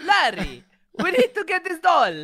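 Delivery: hammed-up Indian accent; mock Indian accent. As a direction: laugh out a cartoonish Indian accent. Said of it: "that was Indian".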